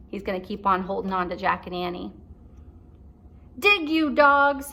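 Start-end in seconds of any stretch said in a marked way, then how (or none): 3.6-4.7 loud, slow commanding yell; pirate captain barking orders; shouting captain. Acted. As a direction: drag out the order loudly. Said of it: "he shouted".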